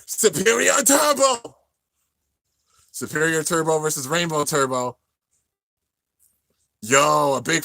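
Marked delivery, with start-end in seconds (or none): 0.0-1.6 battle cry. Retold or imitated. Drawn-out move callout.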